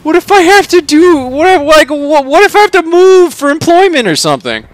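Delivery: high-pitched, panicked exclaiming; Charlie. A narrator's reading. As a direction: blurt out objections in rising alarm. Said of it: "exclaims Charlie".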